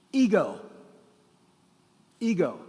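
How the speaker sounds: "Ego" said the English way, loud and clear; English pronunciation. Shown as a demonstration. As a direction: repeat "Ego" for the congregation. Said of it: "let's pronounce this in English".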